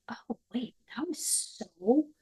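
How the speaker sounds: hushed, awed whisper. Retold or imitated. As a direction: breathe it out like a discovery. Acted quote of sudden realisation.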